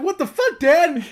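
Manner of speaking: put-on high, loud kid yelling at dad; mock yell. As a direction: shriek it like a bratty kid. Kid swearing at dad, joke.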